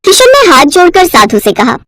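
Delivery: plain reading voice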